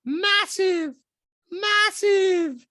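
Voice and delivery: loud falsetto impression, high and insistent; Diana. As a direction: hammer it home in a squeaky mock voice. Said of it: "Diana goes".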